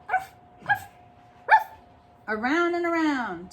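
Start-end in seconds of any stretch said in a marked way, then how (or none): 0.1-1.7 high yappy barks; mock yappy dog; dog barking. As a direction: bark up high as he chases. Dog chasing.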